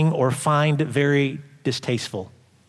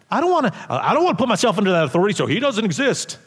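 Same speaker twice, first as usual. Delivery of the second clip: mock rushed excuse; 6 semitones higher, much faster than usual. Mocking unbeliever, rushed.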